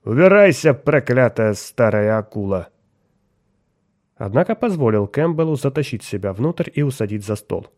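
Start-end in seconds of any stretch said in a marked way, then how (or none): 0.0-2.7 put-on loud slurred drunken curse; slurred cynical barfly. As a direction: snarl it like a drunk sailor. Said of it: "пьяным голосом".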